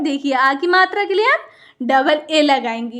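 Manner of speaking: ordinary talk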